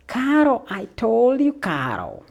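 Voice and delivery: loud, high mock Celtic accent; hammed-up Scottish or Irish-style call; thick accent. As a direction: imitate a thick Celtic accent at full volume. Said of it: "it was so fucking thick".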